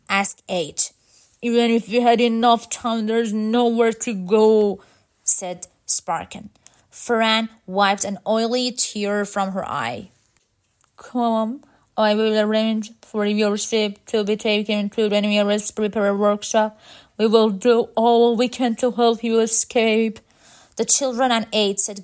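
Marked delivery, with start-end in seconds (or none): 1.4-4.8 louder, level robot voice; resigned mechanical leader; robot leader, hopeless. Acted. Robot leader admits no escape. 11.0-20.2 louder, level robot voice; steady mechanical leader; robot leader, resolute. Acted.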